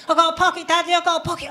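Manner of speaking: put-on a three-year-old repeating herself proudly; toddler voice. Daughter showing off her coat pocket, in a lightly voiced toddler voice. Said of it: "following me around the house saying".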